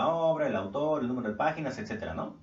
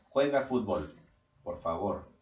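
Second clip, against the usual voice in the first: polite command; 2 semitones lower than usual. Shown as a demonstration. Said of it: "lo vamos a hacer como una orden".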